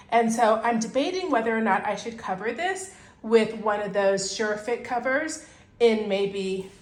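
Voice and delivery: ordinary talk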